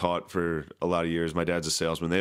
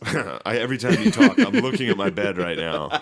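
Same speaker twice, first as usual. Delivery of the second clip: loud, breaking into laughter; laughing while talking; 4 semitones higher, 7 dB louder than usual. Real, not acted. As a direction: get it out while really cracking up.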